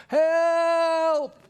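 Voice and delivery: put-on high loud child's cry of help. Son in the storm calls his parents with a high loud help. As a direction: cry out like a scared little boy. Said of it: "this little voice cry out".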